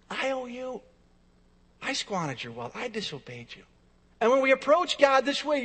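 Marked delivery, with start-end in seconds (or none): 0.1-3.6 soft, subdued confession with pauses; humble repentance. Acted. Sinner admitting a debt to God, humble repentance. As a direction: confess quietly, letting the pitch sink.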